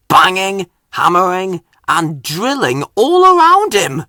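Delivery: noisy yard. A narrator's reading. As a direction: raise the voice as the noise builds.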